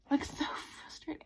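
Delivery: whispered, defeated repeat of so frustrating; hushed, exasperated whisper; frustrated whisper. Real, not acted. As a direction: mutter it under your breath in despair. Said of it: "this is so frustrating".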